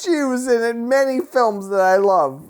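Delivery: high, loud lament; mock anguished wail. Mourning an eighties actress to his wife, loud high wail. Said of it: "a really strong reaction".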